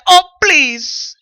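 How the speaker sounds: loud, high scoff, brushing it off; mock the mother's fed-up dismissal; dismissive scoff. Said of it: "Mommy said".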